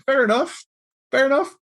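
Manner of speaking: high, light fair enough; mock-cheerful acceptance; breezy shrug. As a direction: toss it off lightly. Shrugging reply to the insult.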